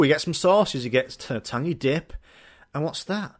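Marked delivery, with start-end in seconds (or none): none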